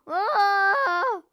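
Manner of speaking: long, loud high "oh" yell; drawn-out attacking holler; battle cry. Real, not acted. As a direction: cry out as you charge. Battle cry while attacking in-game.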